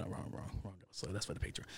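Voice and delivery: hushed, almost whispered aside; a breathy under-the-breath note to self; muttered aside. Real, not acted. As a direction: drop to a near whisper for a quick aside.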